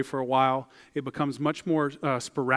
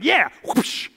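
excited whoosh; 9 semitones higher, 6 dB louder than usual. Pretend. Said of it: "I'd be like".

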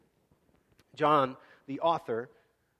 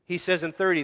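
ordinary talk

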